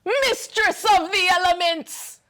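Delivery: high, drawn-out theatrical proclamation; dramatic Storm entrance. Acted. Co-host announcing Storm's grand entrance, dramatic and drawn out. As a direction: announce yourself like a goddess commanding the skies. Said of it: "That was really dramatic".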